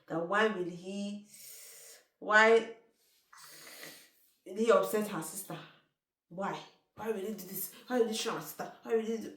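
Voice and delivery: loud, high furious questioning; shouting angry questions at the boyfriend. Retold or imitated. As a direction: confront him, demanding why. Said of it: "went to Desmond's house to go and shout".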